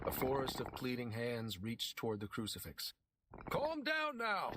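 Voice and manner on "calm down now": much higher, slow holler. A narrator's reading. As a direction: call out above a noisy crowd. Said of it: "Pete hollered above the clamor".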